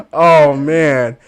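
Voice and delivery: ordinary talk, nothing marked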